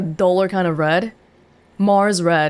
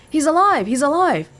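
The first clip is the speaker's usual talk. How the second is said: understated acted yell, pitched up; mild mock yell; 6 semitones higher than usual. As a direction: read a buddy's excited cry with restraint. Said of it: "my buddy's yelling".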